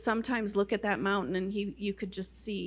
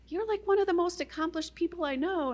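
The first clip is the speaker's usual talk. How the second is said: friend's voice; 8 semitones higher than usual. Retold or imitated. Friend's laughing reply voiced.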